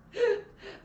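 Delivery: loud high laugh bursting out; burst of laughter. Real, not acted. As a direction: crack up at the memory. Laughs at wig confusion. (éclat de rire aigu)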